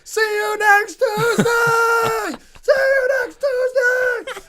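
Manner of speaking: very high, drawn-out scream; yelling. Retold or imitated. Limping rider to staring neighbors, long furious yell. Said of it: "I'm just yelling that".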